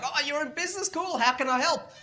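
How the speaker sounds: high, bright mock enthusiasm; eager helper voice. Mocking how today's adults cheer on a young businessman. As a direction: gush like everyone's keen to help. Mimicking eager helpful people.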